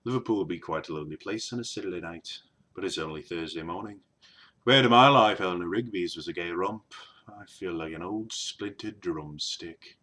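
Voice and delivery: low, quiet, deadpan Liverpool accent; Liverpudlian accent. Shown as a demonstration. Vlogger saying a favourite line about Liverpool, low Liverpudlian accent. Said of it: "favourite things to say in that accent".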